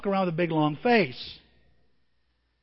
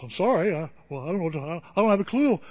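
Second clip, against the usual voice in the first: clueless God. Pretend. Mocking a clueless, distant God.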